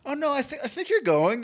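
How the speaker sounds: mock polite dismissal. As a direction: nudge her out with fake sweetness. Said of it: "I was like".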